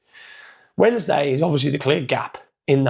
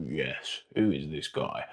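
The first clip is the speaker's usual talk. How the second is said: mock deep voice; 11 semitones lower, 12 dB quieter than usual. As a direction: voice dropped low and quiet, playing a stranger. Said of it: "it was deep yesterday".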